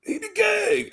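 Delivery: the line shouted out like a crowd chant. Retold or imitated. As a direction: yell it like the whole arena. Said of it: "It was like".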